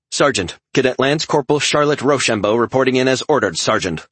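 brisk soldier reporting in; crisp military report. A narrator's reading. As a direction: snap off a formal report. Cadet reporting to sergeant.

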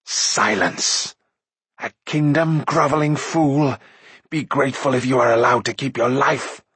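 forceful, contemptuous rebuke; scornful. A narrator's reading. Akron silencing grovelling Mag, scornful. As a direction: cut him down with scorn. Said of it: "faced him scornfully".

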